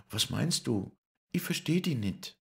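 very high, startled voice; Lisei's voice. Acted. Lisei startled by Paul's proposal, very high voice. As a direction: ask in a high, flustered voice. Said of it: "rief sie".

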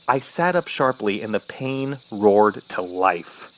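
usual speaking voice

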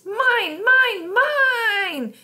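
put-on very high, loud toddler shrieks; piercing shriek. A narrator's reading. Toddler losing her cookie, eardrum-piercing shriek. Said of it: "It was an eardrum-piercing shriek".

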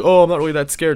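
high, loud sarcastic protest; sarcastic mock scare. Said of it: "had a little sarcastic, like, screaming".

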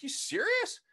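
can't believe what he's hearing; incredulous. Retold or imitated. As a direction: pitch jumps way up in disbelief. Retelling disbelief at the priest.

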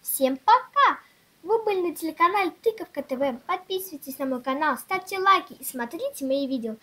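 ordinary talk, nothing marked

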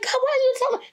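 high, startled why-me; mock-outraged cry to God; incredulous protest. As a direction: pitch jumps way up in disbelief. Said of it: "I'm like".

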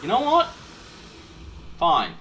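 high, loud taunting yells; aggressive shouting. Real, not acted. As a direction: taunt the monster loudly. Fighting the monster.

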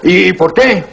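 high, sharp mock challenge; wife's high, defiant comeback; wife's defiant retort. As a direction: fire it back high like a bold wife. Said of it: "con ese carácter fuerte".

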